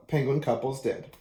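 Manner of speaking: usual speaking voice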